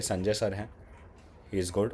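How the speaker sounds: usual speaking voice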